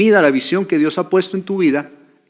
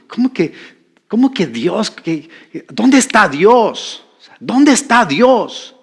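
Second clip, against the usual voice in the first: raised, drawn-out indignant demand; Gedeón angry with God; 5 semitones higher, 3 dB louder, slower than usual. Acted. Preacher acting a man angry with God, indignant. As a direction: demand an answer in acted outrage. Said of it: "él se enojó con Dios".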